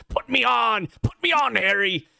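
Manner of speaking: high, eerie villain urging; whispering mask. Acted. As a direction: coax him like a possessed mask. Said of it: "had the mask whispering to him".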